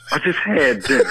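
usual speaking voice

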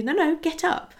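God playfully refusing to let her sleep; playful insistence. Acted. God insisting she gets up.

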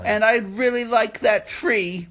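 slow, high, mock-pitiful request; pleading customer. Imitating a customer begging for the display tree, pitiful. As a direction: draw out the imitation plea.

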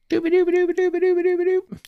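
rapid high-pitched dooba-dooba babble; high, fast nonsense babble. Pretend. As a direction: rattle it off high and silly. Playful nonsense noise while waiting.

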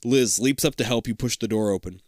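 normal talking voice

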